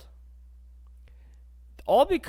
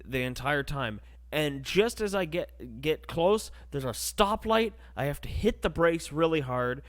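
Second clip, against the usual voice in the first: ordinary talk, nothing marked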